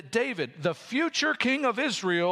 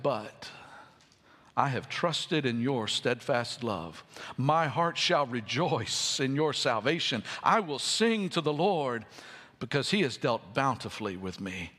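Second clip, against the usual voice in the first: tender trusting reading; 6 semitones lower than usual. Acted. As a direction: read it as a gentle promise kept. Reads trusting final verses.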